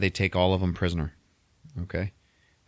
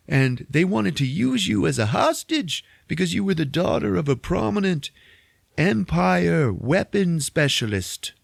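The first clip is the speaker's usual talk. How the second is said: put-on overwrought old rebel; 10 semitones higher than usual. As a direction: plead your excuse in a hammed-up voice. Saw's character voice explaining himself.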